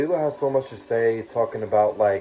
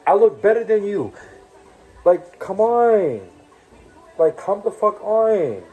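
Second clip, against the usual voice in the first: heated insults; 5 semitones higher than usual. Real, not acted. Heated rant insulting Lilo.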